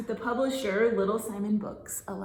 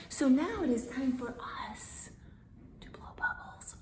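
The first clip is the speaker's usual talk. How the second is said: hushed whisper building suspense; 8 dB quieter, faster than usual. Acted. Teacher whispers to the class before bubbles. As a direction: whisper to make it exciting.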